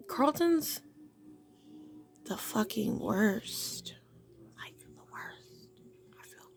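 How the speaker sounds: hushed conspiratorial whisper. Real, not acted. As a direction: whisper it like a secret. Whispered aside about Carlton.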